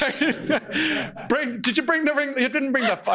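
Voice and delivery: very high-pitched comic scolding; mock exasperation. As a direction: scold your helper for forgetting, hammed-up. Acted scolding of hapless friend.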